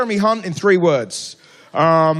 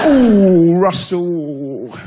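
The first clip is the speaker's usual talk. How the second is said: loud teasing ooh; hammed-up impressed coo; mock impressed; 4 semitones lower, 3 dB louder, much slower than usual. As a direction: tease him like he boasted. Mocking reaction to brag.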